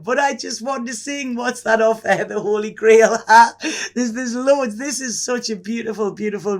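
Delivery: laughing with delight at the cards; laughter breaking into a teary catch. Real, not acted. Overjoyed by the cards.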